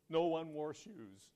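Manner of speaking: slow, drawn-out mock discouragement; mildly mock depressed. First salesman reporting back from the island, mildly depressed. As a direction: lightly play a let-down salesman, slowly. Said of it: "negative attitude and was somewhat depressed".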